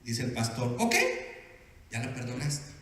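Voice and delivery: ordinary delivery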